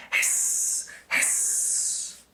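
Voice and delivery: hissing like a kettle; soft kettle hissing. A narrator's reading. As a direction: let the steam hiss out. Kettle sound.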